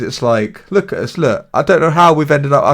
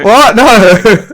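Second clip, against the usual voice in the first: high, loud startled protest; surprised denial; 8 semitones higher, 8 dB louder than usual. Real, not acted. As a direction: caught out, deny it fast. Accused of adding themselves.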